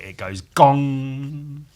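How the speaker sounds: slow booming gong; drawn-out gong; sound effect. Pretend. Reading sound effects aloud.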